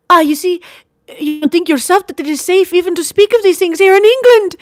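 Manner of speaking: anxious lady. A narrator's reading. Sister warning brother of danger, urgent and high. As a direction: press him with a frightened question. Marguerite voiced by narrator.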